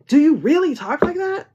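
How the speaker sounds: high, loud incredulous question; mock his disbelief; imitating the guy. The card player asking, imitated high and loud. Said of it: "And he's like".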